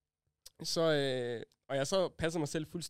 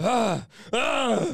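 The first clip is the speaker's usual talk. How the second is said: loud mock yell; 5 semitones higher, 6 dB louder than usual. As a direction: bark out a sudden yell. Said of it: "Så begynder jeg at råbe".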